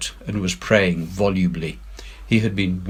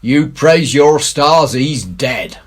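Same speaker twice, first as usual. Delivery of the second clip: put-on louder, higher old pirate, slow at the end; Silver's pirate voice; 7 semitones higher, 9 dB louder, slower than usual. A narrator's reading. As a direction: scold the scared crew heartily. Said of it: "said Silver".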